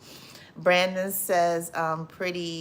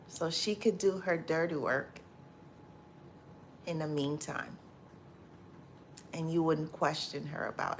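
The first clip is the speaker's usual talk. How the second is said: much softer, resigned; quiet; 3 semitones lower, 9 dB quieter than usual. Real, not acted. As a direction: sink into quiet disbelief. Resigned reflection on her past.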